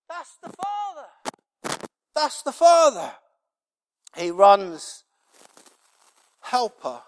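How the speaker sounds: high, loud cry from the pulpit; impassioned preaching. Real, not acted. Preacher telling how the father runs to the cry, impassioned shout. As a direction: preach it at full voice, high and drawn out.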